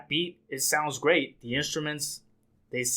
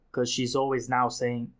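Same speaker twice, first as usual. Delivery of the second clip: normal talking voice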